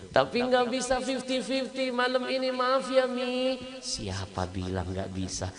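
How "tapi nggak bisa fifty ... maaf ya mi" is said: put-on higher, childlike apology to mother; pleading son. Son apologizes to his mother, high and boyish. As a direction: sound like a sorry little boy.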